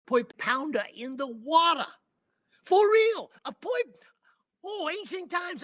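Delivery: high, loud cry of discovery; thrilled yell over an ancient find; excited shout. Retold or imitated. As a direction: shout it like you struck treasure. Finding ancient artifact in water.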